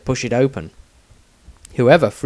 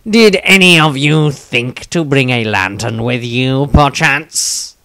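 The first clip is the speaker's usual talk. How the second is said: louder, self-satisfied needling; too smug; 2 semitones higher, 6 dB louder, slower than usual. Acted. Tobias needling the group about the missing lantern, smug. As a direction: savour showing the others up. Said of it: "a voice that was too smug".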